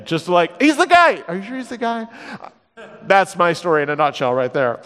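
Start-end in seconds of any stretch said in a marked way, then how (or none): 0.6-1.2 high, emphatic exclamation; acted burst of conviction; confident proclamation. Acted certainty, then doubt. 1.3-2.1 soft, unsure question; acted small-voiced doubt; doubtful questioning. Acted certainty, then doubt.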